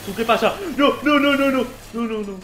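high, loud, frantic disbelief; panicked shouting. Real, not acted. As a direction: shout in alarm as the threat returns. Attacked again, disbelief. (gritos agudos de pánico e incredulidad)

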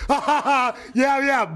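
high-pitched mock cackle and cheer; imitating own loud voice. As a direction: act out your own obnoxious ringside racket. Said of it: "my loud ass voice".

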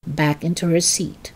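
ordinary delivery, nothing marked

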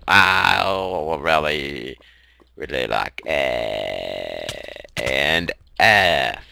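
slow, low drawn-out announcer; intercom voice. Acted. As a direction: stretch the words out slowly. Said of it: "That's my intercom voice".

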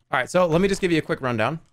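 usual speaking voice

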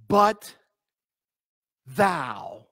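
"But" and "thou." set apart by a long pause; emphatic THOU. Shown as a demonstration. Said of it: "the thou is emphatic".

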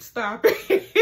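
real laughter through the words; laughing. Real, not acted. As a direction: crack up on the repeat. Repeats line, cracking up.

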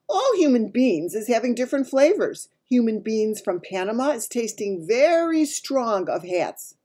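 lightly voiced giant, emphatic; a hint of a big giant holding forth; lightly voiced lecturing giant. A narrator's reading. Giant telling Sophie how humans taste, lightly emphatic.

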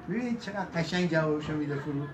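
ordinary delivery

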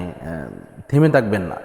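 ordinary delivery

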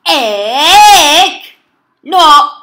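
very loud, high, stretched shouts; scream-shout it right into the mic; all-out yelling. Real, not acted. Player going extra close on one last try, yelling.

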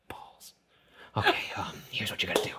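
hushed whispered instructions. Retold or imitated. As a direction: whisper it urgently. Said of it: "And he's like".

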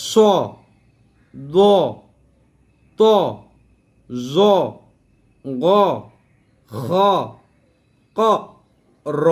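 স, দ, ত short and rounded, one at a time; short correct pronunciation. Shown as a demonstration. Teacher modelling for students, short heavy letters. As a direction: give each of স, দ, র heavy, without stretching. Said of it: "উচ্চারণ হবে এরকম".

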